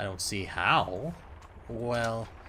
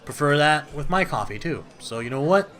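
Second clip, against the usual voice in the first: louder, higher defensive sass; defensive retort; 4 semitones higher, 9 dB louder than usual. Real, not acted. Gamer defending sweet coffee to a character, sassy. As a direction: push back on the judgment.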